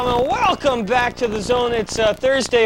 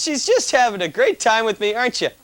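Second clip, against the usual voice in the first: normal talking voice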